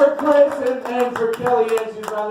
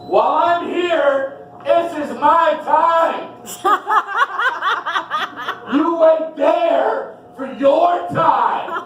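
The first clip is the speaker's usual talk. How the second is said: high, slow angry shout; 8 semitones higher, slower than usual. Acted. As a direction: stand your ground at the checkout. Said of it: "yelling at guy behind".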